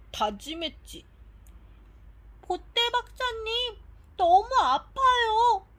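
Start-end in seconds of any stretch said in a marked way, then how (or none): 2.4-5.7 높은 목소리로 느리게 칭얼대듯; 앓는 아이처럼 울먹이며 호소; 아파서 울먹임. Acted. 아픈 예나가 박사에게 호소. (아파서 울먹이는 목소리)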